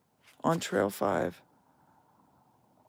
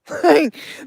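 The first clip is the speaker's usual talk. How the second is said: a sudden, high burst of laughter; loud laugh; 14 semitones higher, 13 dB louder than usual. Real, not acted. Laughs at own disbelief.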